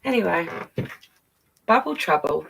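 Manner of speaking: plain reading voice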